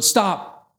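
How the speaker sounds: sudden high, loud shout; king stopping the whipping of his daughter, shouting; king's yell. A narrator's reading. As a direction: cut the punishment off with one shout. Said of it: "the king yells out".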